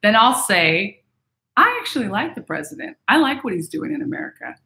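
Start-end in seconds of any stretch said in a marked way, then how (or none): 1.6-4.5 high, gentle, sincere voice; gently stating an honest opinion; gentle and truthful. Shown as a demonstration. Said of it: "I say it gentle and I say it truthfully".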